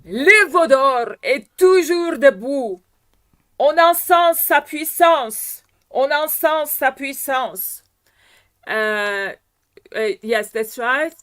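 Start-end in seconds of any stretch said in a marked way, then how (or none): 0.0-7.8 loud, raised declamation of "Le Vaudor est toujours debout"; declaimed French diction demo. Shown as a demonstration. Coach shows singer clear French diction, declaimed loudly. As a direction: project each French word of "On encense sa puissance", high and forceful. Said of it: "The pronunciation is absolutely not clear".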